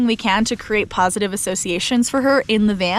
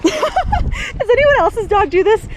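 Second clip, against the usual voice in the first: slow, higher, laughing as she asks; laughing question; 12 semitones higher, slower than usual. Real, not acted. Owner asking viewers about her dog, laughing. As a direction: share the joke with the viewers, still laughing.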